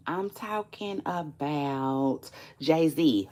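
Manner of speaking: ordinary talk, nothing marked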